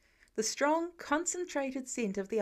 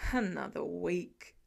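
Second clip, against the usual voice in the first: slow, lower grumble; grumbling; 5 semitones lower, slower than usual. A narrator's reading. Tony impatient about the wait, slow low grumble. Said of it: "Tony grumbles".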